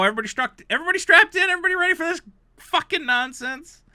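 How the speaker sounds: high, loud mock ride-operator call; mock announcer shout. Warning listeners about the nonsense, loud mock announcer. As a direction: yell it like strapping people into a ride.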